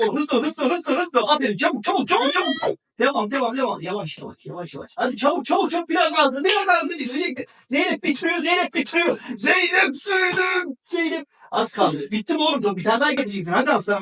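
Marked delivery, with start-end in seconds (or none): 0.2-2.8 high, urgent cheering; mother cheering her son in a race; excited cheering. Real, not acted. 3.8-4.9 much softer, lower "yavaş"; soft caution. Real, not acted. Calming child eating too fast. 5.0-11.3 mother shouting her daughter's name at the finish; excited shouting. Real, not acted. Shouting encouragement at race end.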